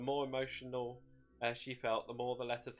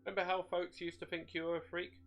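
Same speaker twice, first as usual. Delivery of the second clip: higher, smug taunting voice; gloating demon voice; 7 semitones higher than usual. A narrator's reading. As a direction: rub it in with glee. The shade gloating.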